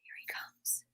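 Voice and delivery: hushed unvoiced whisper. Real, not acted. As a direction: whisper so the cat isn't startled. Whispering as the cat approaches.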